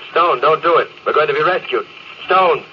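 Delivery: high, urgent pleading; captain pleads desperately with Stone. Acted. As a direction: beg him to stop. Begging him not to die.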